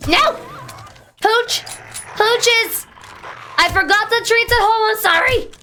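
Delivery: mock panic at the hounds; squealing, frantic game panic; panicked yelp. Panicked cry to game dogs.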